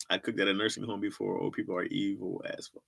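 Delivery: normal talking voice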